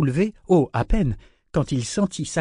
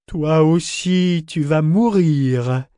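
loud, drawn-out gloating threat; grim satisfaction; 3 semitones higher, 6 dB louder, much slower than usual. A narrator's reading. Ship to its victim as revenge comes, gloating satisfaction. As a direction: savour the threat slowly and loudly. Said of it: "dit-il avec satisfaction".

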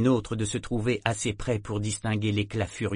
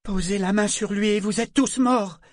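matriarche outragée; 11 semitones higher, 6 dB louder than usual. Acted. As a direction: menace criée, plus aiguë et forte. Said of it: "celui d'une matriarche outragée". (matriarche outragée)

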